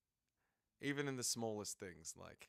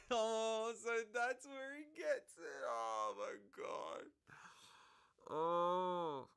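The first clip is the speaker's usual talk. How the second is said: laughing delight; 12 semitones higher, slower than usual. Real, not acted.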